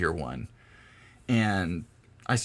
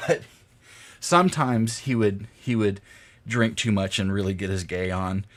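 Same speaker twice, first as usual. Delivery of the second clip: ordinary talk, nothing marked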